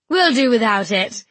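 breezy, carefree; jaunty. A narrator's reading. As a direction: toss it off like a lark. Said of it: "Prim said jauntily".

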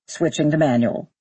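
low, matter-of-fact pilot callout; astronaut on radio. Acted. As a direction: announce the step over radio. Astronaut reports taking manual control.

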